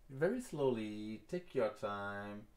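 drawn out, slow and unhurried; slow the words right down as you advise patience; stretched-out slow delivery; slow and easy. Shown as a demonstration. Telling viewers to go slowly.

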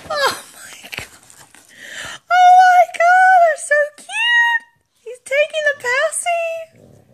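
high, loud gushing squeal over the dog; overjoyed dog mom shrieking; excited squeal. Real, not acted.

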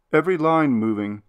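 ordinary delivery, nothing marked

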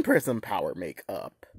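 low voice. Shown as a demonstration. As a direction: drop the voice down low. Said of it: "two takes".

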